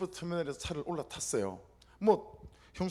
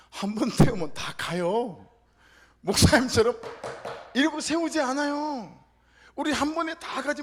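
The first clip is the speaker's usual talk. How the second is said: scolding build; 7 semitones higher, 7 dB louder than usual. Retold or imitated.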